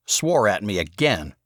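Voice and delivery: usual speaking voice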